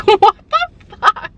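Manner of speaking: high, very loud "what the fuck"; loud, high-pitched freak-out; explosive disbelief. Acted. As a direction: blast it out in a high-pitched outburst. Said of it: "then there are moments where you're like".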